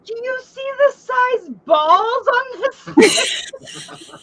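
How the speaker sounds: very high, slow, laughing half-shout; horrified laughing shriek. Retold or imitated. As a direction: shriek it out between laughter and disbelief. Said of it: "she's like".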